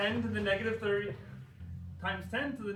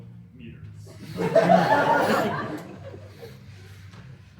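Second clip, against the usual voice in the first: airy helpless giggle; wheezing laugh; breathy laughter; 12 dB louder than usual. Real, not acted. Laughs at wrong units.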